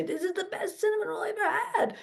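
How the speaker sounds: put-on high, quick New York accent, laughing. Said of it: "however the New York people talk".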